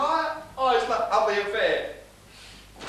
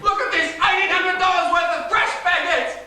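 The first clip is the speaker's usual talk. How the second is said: sarcastic shouting; 5 semitones higher than usual. Acted. Father yelling sarcastically at his daughter through the door. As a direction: yell mockingly at the locked door.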